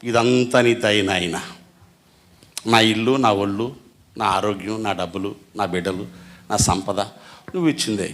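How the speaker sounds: bow down and thank God quietly; humble prayer to God. Pretend. Believers praying to God, soft humble thanks. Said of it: "మరి మనం ఏం చేయాలి".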